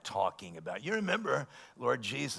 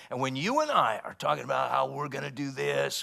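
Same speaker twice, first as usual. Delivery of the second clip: ordinary delivery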